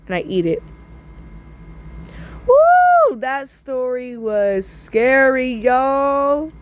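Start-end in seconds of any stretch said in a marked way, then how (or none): 2.5-3.1 very high loud whoop; hyped shriek after a story; ecstatic whoop. Real, not acted. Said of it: "host reacts". 3.2-6.5 slow emphatic "scary"; drawn-out reaction; slow drawn-out remark. Real, not acted. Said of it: "host wraps up".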